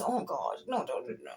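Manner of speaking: shrinking away, barely voiced; inner recoil. Inner self shrinking from negativity, hushed pleading. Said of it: "my internal being just kind of goes".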